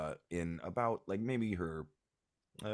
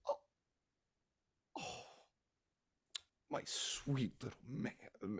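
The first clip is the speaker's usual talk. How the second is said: tender; 6 semitones higher, 6 dB quieter than usual. Acted. Mother melts over gift.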